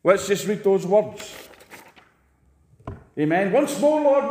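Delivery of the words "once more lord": louder, higher commanding cry of Once more; proclaiming Once more to God; bold declaration. Shown as a demonstration. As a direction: call it out as a demand, not a plea. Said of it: "I didn't sing that begging God".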